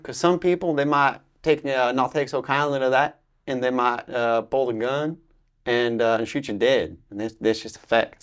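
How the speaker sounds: put-on American Southern drawl. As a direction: draw out the words like a mock Southerner. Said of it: "Southern North American accent".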